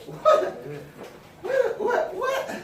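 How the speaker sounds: high, laughing What; amused disbelief. Real, not acted. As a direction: squeal What in amused surprise. Preacher reacts in amused disbelief.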